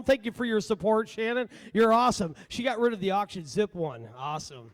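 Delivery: normal talking voice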